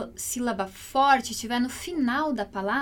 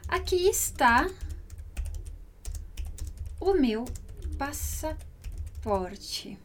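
slow dictation; 4 dB quieter, much slower than usual. Shown as a demonstration. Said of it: "slow translation demo".

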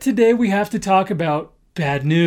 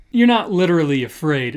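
ordinary talk

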